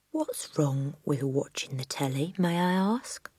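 quiet, menacing question; soft and dangerous. Acted. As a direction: drop your voice and threaten. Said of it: "soft and dangerous".

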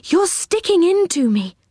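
high, complaining character voice; pained protest. A narrator's reading. Silky poked by kettle spouts, high protest.

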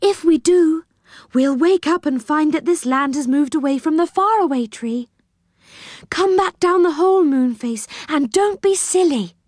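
high, urgent pleading; alarmed, high-pitched scolding; urgent warning. Acted. Silky urgently warns and scolds Moonface.

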